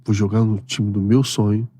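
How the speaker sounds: quiet, emotional remembering of father; softly, holding back tears; quiet, choked-up memory of his late father; moved. Real, not acted. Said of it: "veio a imagem do meu pai".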